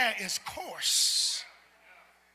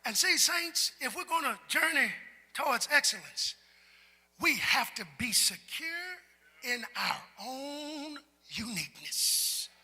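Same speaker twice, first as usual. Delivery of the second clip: ordinary talk, nothing marked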